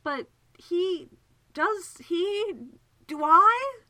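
very high, loud, halting questions; helpless befuddlement. Acted. Segundus realises both men's feelings, helplessly flustered. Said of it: "He gazed helplessly at her".

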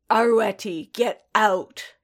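loud furious snarl. Acted. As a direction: throw him out with a snarl. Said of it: "Paz snarled".

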